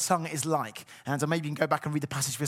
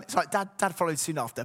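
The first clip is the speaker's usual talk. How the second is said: ordinary talk, nothing marked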